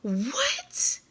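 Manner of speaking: squeaked high in utter disbelief; a stunned, breathy high-pitched what; shocked what. Retold or imitated. Reacting to the pastor's lie, shocked high squeak.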